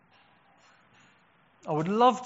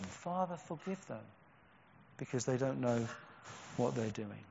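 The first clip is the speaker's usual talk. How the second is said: much softer, hushed plea to the Father; soft dying prayer; 4 semitones lower, 9 dB quieter than usual. Acted. Jesus praying for his crucifiers, hushed and soft. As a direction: barely voice it, like a last breath.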